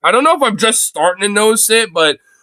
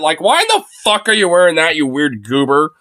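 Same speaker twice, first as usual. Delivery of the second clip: ordinary delivery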